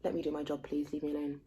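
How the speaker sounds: quietly exasperated clerk on the phone. Retold or imitated. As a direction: sigh the words out, fed up.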